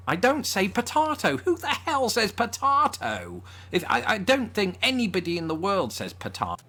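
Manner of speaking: high-pitched comic outrage; exasperated high-pitched rant; incredulous protest. Real, not acted. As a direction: squeak in disbelief, far above normal pitch. Mock outrage at absurd pronunciation.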